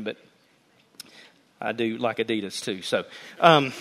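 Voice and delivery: ordinary delivery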